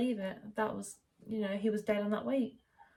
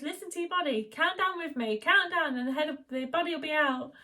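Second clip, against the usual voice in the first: urgent coaching; 7 semitones higher, 5 dB louder than usual. Retold or imitated. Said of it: "And she says".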